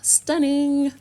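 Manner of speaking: high, loud delighted praise; excited. Real, not acted. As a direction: savour it with real delight. Admiring finished nails.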